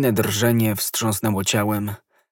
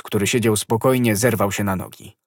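usual speaking voice

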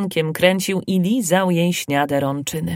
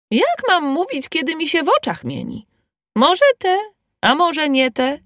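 gossiping village woman; 9 semitones higher than usual. Acted. Village gossip voiced by narrator.

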